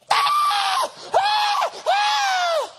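put-on loud, piercing high baby cries; repeated high-pitched mock infant screams; imitated newborn wail. Newborn answers its cooing parents, imitated wailing.

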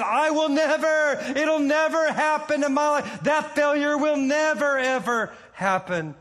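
high, insistent retold promise; emphatic vow. Preacher voices the disciple's vow never to fail, emphatic. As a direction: swear it, leaning hard on each "never". Acting out Peter's confident vow.

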